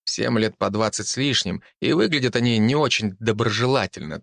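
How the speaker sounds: ordinary delivery, nothing marked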